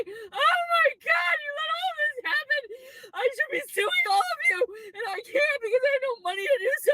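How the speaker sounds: high, sobbing shouts that crack into tears; crying and yelling. Real, not acted. Venting at the authorities who took her child, sobbing and shouting. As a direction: wail it out through real tears, voice breaking up high.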